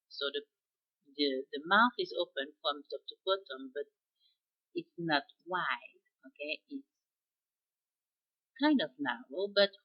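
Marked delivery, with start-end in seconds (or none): none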